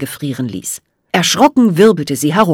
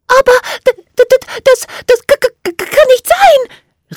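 high, loud, stammering fairy voice; teeth-chattering, panicked little voice; desperate cry; 5 dB louder than usual. A narrator's reading. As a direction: stutter in despair, very high-pitched. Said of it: "rief sie verzweifelt".

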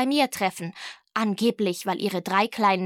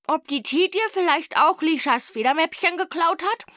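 higher, louder musing question; lively higher-pitched character question; wondering girl; 7 semitones higher, 4 dB louder than usual. A narrator's reading. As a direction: voice a schoolgirl floating a suspicion. Emma speculates about a pencil-case thief.

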